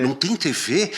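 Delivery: mock indignation. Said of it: "Ele fala, indignado".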